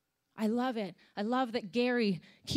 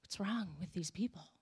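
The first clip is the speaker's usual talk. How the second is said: imitating a low, hushed private thought; muttered judgement; 5 semitones lower, 10 dB quieter than usual. Worship leader looking out at congregation, quietly mimicked judgement. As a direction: act out judging under the breath.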